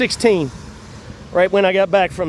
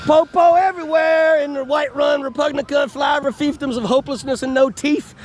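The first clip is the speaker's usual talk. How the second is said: rant shout; 6 semitones higher than usual. Real, not acted. Ranting shout about home state.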